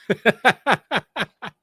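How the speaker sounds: high burst of real laughter; laughing. Real, not acted. Reacts to friend's Hickman jab.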